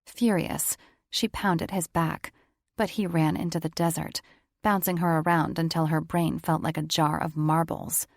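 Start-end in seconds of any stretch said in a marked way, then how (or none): none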